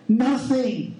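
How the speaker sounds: NOTHING hit hard and alone; emphatic nothing. Real, not acted. Preacher to congregation, Nothing hammered home. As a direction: drive home the single word Nothing. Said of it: "I need to hit this home".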